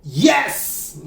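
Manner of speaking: a drawn-out, emphatic "yes"; an exclamation-point yes; enthusiastic yes. Acted. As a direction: give the yes real enthusiasm. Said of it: "That's an enthusiastic yes".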